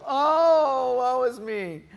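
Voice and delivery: loud, high hammed-up moan; woe is me moan. Sick dad moaning to his family, hammed-up woe. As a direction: overplay the sick dad's misery. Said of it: "let the whole neighborhood know that I have the stomach flu".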